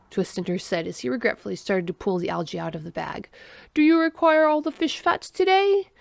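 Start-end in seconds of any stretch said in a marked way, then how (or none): 3.7-5.9 high, raised alien voice; alien's question. A narrator's reading. Alien asks friend about his sandwich, questioning. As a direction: ask it high and formally, as the alien.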